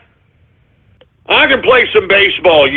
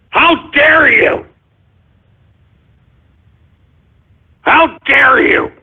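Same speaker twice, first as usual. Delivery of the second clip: indignant; 4 semitones higher, slower than usual. Acted. Host berates the callers.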